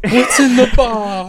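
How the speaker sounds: laughing. Retold or imitated. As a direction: giggle out the movie line. Laughs while repeating the line.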